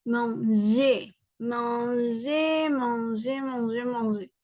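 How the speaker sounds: manger répété, ɑ̃ nasal et é final nets; répéter manger lentement pour la classe. Shown as a demonstration. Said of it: "La prononciation, ça sera". (modèle de prononciation de manger)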